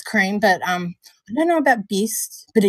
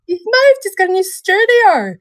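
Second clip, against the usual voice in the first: much higher, louder delight; excited; 9 semitones higher, 4 dB louder than usual. Real, not acted. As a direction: gush about the news. Excited about friend's move.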